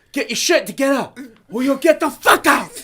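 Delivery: high, loud mock yell; hammed-up angry ultimatum; imitated chef threat. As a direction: threaten to throw the cook out.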